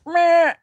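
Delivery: high, silly "meh"; little mitten thumb noise. Pretend. Streamer voices a floppy mitten thumb, silly high noise. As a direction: squeak out a bleat for a tiny thumb. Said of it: "The little thumb is like".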